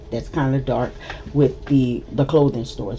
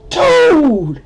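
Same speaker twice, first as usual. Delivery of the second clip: loud, high shocked shout; outraged yell; 12 semitones higher, 8 dB louder than usual. Retold or imitated. The spat-on guy yelling at Marine, loud and shocked. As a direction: bark it out in disgust.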